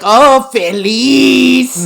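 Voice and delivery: loud, high, long-drawn mock Mexican accent; hammed-up Mexican accent, stretching the final syllable; exaggerated Spanish. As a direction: imitate a gushing Mexican cartoon iguana. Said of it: "she fucking exaggerates it".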